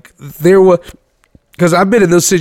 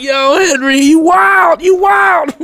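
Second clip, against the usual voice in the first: hyped cheering; 11 semitones higher, slower than usual. Retold or imitated. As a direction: cheer him on like a rowdy crowd. Kids cheering Henry on the bus.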